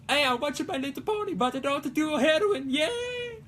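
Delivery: high, bright fake-innocent voice; mock cheerful voice. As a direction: play an overly chipper fan. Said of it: "It's like".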